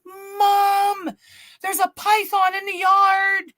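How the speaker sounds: high, drawn-out mock scream; retold panicked scream. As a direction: yell for mom in re-enacted panic. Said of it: "So I start screaming".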